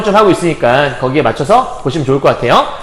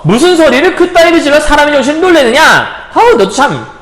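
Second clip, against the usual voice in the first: startled scolding; 6 semitones higher than usual. Acted. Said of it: "부르는 소리에 춘향이 깜짝 놀래서".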